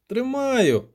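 high strained cry; groaning with effort. A narrator's reading. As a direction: groan it out while hanging on. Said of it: "стогнав поет".

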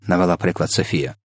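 plain reading voice

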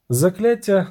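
plain reading voice

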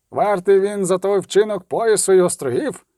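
high-pitched rabble-rousing cry; shouting craftsman. Acted. Craftsman in leather apron to the crowd, shouting. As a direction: call for punishment, voice climbing high. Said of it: "крикнув ремісник у шкіряному фартусі".

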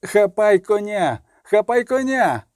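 high, drawn-out cry to grab the horse; urgent call. A narrator's reading. Warrior calling to a comrade amid battle, urgent high call. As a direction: call it out urgently, pitched well up.

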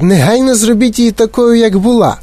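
high-pitched frantic demand; crying out. A narrator's reading. Mother orders Wonka to restore Violet, crying out. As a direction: demand it in outrage. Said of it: "закричала пані Борегер".

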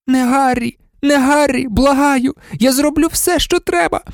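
high, desperate begging; pleading mother. A narrator's reading. Harry's mother begging Voldemort, high desperate plea. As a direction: beg for your child's life. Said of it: "голос матері відлунював гучніше".

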